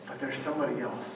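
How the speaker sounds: soft, fast, muttered to himself; quiet realization. Retold or imitated. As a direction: let it slip out under his breath. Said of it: "And then he remembers".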